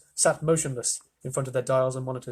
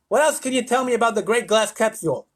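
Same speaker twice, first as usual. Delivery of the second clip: loud, high lisped demand; impatient lisping official; lisping President; 9 semitones higher, 6 dB louder than usual. A narrator's reading. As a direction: interrogate with a pompous lisp. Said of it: "I'm reading with a lisp now".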